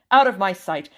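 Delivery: loud furious order; angry shout. Acted. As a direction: roar them out of the room. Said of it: "he shouted".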